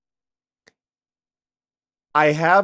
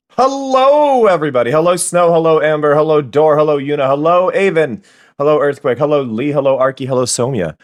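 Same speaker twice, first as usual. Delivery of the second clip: loud cheery greeting; 3 semitones higher, 10 dB louder than usual. Real, not acted. Streamer greeting each chat viewer, loud cheerful calling out. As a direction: call out each name with glee.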